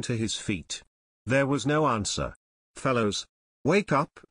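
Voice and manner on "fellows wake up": usual speaking voice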